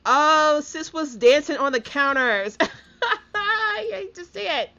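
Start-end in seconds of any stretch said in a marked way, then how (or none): 2.6-3.3 a burst of high, loud laughter; squealing laugh. Real, not acted. Laughing at her sim dancing. 3.4-4.7 said through high, loud laughter; giggling, pitched way up; laughing. Real, not acted. Laughing at sim on counters.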